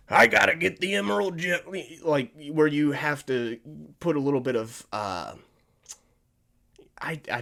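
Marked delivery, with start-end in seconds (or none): 0.1-1.6 higher, louder, fast rough tough-guy voice; rough Knuckles demo; gravelly Knuckles voice. Shown as a demonstration. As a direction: growl it like a brawler. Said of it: "maybe gravelly voice would work more".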